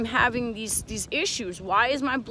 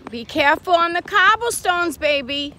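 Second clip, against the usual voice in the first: mother calls to her son on the cobblestones, louder; calling out; 6 semitones higher, 8 dB louder than usual. Real, not acted. Calling out to toddler.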